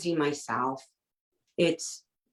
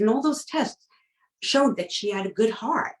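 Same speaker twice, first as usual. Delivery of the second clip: higher, pressing protest; upset insistence; 7 semitones higher than usual. Retold or imitated. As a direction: push back in disbelief. Confronting vet about heart claim.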